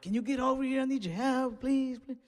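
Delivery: high pleading mock sob voice; mock crying cousin. Imitating the cousin crying on the phone, high pleading. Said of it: "She was crying".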